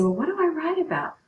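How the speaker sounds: high, quick puzzled student voice; a lightly voiced confused student; imitating a puzzled student. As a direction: play the stumped student asking for help. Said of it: "and you're gonna say".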